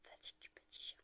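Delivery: soft whisper to a puppy. Real, not acted. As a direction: breathe it over the sleeping dog.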